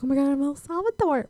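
high-pitched excited exclamation; giddy retold excitement. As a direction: act out a thrilled arrival, voice lifting high. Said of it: "I was so excited".

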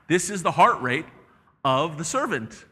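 usual speaking voice

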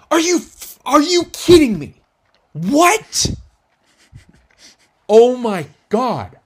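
high, loud mock rage, last words slowing down; patient losing it over the missed photo, in a hammed-up high furious yell; furious outburst. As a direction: blow up at the nurse like a psycho. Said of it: "Like, you get pissed".

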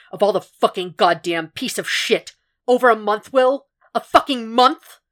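raging. A narrator's reading. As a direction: rant furiously, slowing to hit the last words. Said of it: "he raged and paced".

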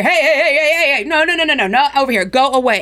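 loud, high, frantic cries of hey and no; yelling in a panicking market crowd; panicked shouting. Acted. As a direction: shout them away in alarm.

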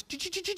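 high, soft doot doot like tiny feet; mouse scurrying noise. Retold or imitated. Mimicking scurrying mice.

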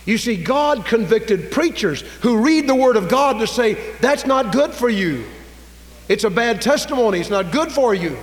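high, forceful pulpit delivery; full-force preaching. Real, not acted. Preacher telling what God convicted preachers to say, full-force preaching. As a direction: drive it home loudly, pitched high.

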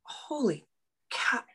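realization. Retold or imitated. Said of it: "looking at the sky going".

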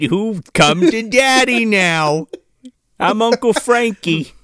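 Frank impression. Mocking an actor's Frank, hammy put-on voice. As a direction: ham it up slowly as a sleazy uncle.

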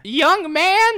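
loud, very high mock old-lady call; old lady shouting. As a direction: holler it in a hammed-up old-lady voice. Co-host plays the trapped old lady.

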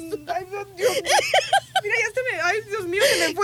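high, breathless from laughing; laugh-crying. Real, not acted. Trying to recover from the nurse's joke, laugh-crying. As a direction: sigh it out through tears of laughter.

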